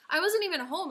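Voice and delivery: mock yelled denial to her mom; indignant protest. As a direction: yell back in self-defence. Defending herself against angry mom.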